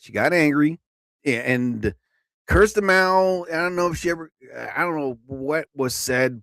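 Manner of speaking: ordinary talk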